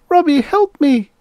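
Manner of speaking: put-on very high, loud child voice; little girl. A narrator's reading. Little girl excitedly tells mother about robot, babbling. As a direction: pipe up as a small girl. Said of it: "the little girl began babbling".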